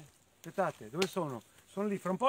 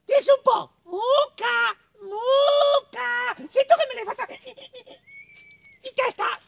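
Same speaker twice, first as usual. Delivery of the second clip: very high, loud squeaky mole; little mole; 6 dB louder than usual. Acted. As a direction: squeal the calls, stutter the accusation. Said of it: "non era stato neanche il maiale".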